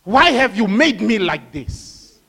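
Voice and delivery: loud, slow protest from the clay; the clay cries out at its maker; a loud, drawn-out complaint; clay complaining. Acted. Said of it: "the clay speaking".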